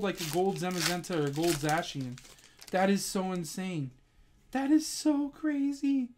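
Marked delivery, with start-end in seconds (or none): 4.5-6.1 high, slow, drawn-out amazement; amazed disbelief. Real, not acted. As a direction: stretch it out in happy disbelief. Amazed at the pull.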